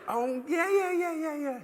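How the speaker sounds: high, squeaky string of yeahs; mock chatty woman on her mobile; imitated phone chatter. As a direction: mimic a shrill caller agreeing away. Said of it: "She's saying like this".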